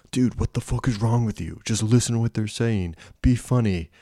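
pushed, impatient inner-voice rant; exasperated self-scolding. Retold or imitated. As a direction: scold yourself like a fed-up friend. Scolding himself in a retold thought.

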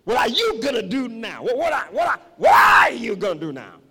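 loud, repeated hammed-up demand; mock challenge. Preacher voicing the lecturer eyeing a student, mock shouting. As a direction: stammer into a booming challenge.

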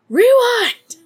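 high, loud shriek; screamed command. Retold or imitated. Killer screams at the tape, full-voiced shriek. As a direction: scream it out in one burst. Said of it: "just screams".

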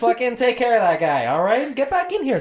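high, urgent mobster command; mob boss orders. Acted. Mob boss ordering a hit over a nickname, urgent. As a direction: send them off with urgency.

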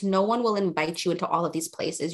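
ordinary delivery

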